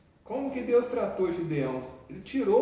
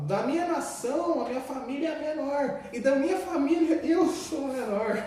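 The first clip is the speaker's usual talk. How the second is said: higher, lightly whiny voice of Gideon; self-pitying Gideon; 6 semitones higher than usual. Retold or imitated. Gideon excusing himself to God, a lightly whiny plea. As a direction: act out a small man belittling himself. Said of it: "ele falou assim".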